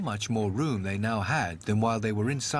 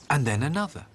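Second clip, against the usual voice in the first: plain reading voice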